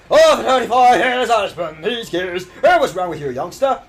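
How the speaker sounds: loud, put-on old-timer voice; old-timer game character. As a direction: grouse at a youngster like a veteran worker. Voicing a game character's dialogue.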